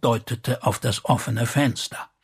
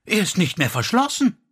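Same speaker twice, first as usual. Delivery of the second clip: high, quick cry of discovery; alarmed exclamation; 8 semitones higher, faster than usual. A narrator's reading. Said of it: "rief sie".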